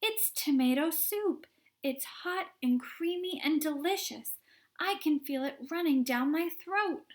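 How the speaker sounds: high, loud thrilled gushing; excited girl. Acted. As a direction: gush over the flavour. Violet raving about taste.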